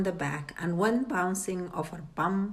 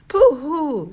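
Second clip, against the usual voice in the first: high, much louder hooting boast; excited hoot; 8 semitones higher, 10 dB louder than usual. A narrator's reading. Cheryl boasting to her father, loud excited hoot. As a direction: whoop it like a cocky kid.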